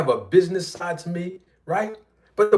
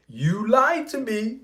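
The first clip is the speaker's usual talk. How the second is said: slow, softer "You lied to me"; playful mock-betrayed repeat; mock wounded; 4 semitones higher, 5 dB quieter, slower than usual. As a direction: pretend hurt, drawn out. Repeated teasing accusation.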